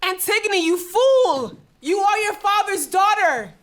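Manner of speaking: high, loud despairing cry; anguished outburst. Acted. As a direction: shout her down in grief and fury. Ismene cries out at her sister.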